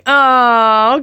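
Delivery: ordinary talk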